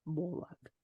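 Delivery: mock Russian accent with the R dropped; bad Russian accent. Shown as a demonstration. Reader excusing the misread name, bad Russian accent. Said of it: "do a bad Russian accent".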